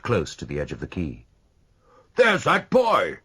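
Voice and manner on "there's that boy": high, loud, slow accusing call. A narrator's reading. Godolphin calling out as he spots Donna. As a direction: point him out to the crowd. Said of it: "he called".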